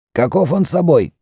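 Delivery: high-pitched questioning priest; Mefres the priest. Acted. High, pressing question. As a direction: priest asks the Greek for a description, high and insistent.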